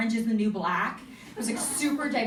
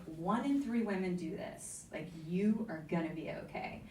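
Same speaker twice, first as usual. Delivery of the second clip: matter-of-fact reassurance; 3 semitones lower, 6 dB quieter than usual. Retold or imitated. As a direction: retell it as calm, plain fact. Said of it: "a matter of fact woman".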